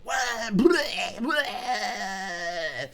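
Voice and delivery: high, drawn-out mock bawling screech; kid's goblin cry. Imitating the kid crying in the barber seat, goblin wail. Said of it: "And he's like".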